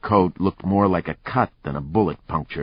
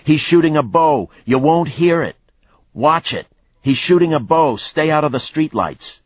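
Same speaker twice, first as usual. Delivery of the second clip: high, loud shout; shouted warning; 9 semitones higher, 5 dB louder than usual. Acted. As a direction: call it out to the team. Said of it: "Lucas shouted at the others".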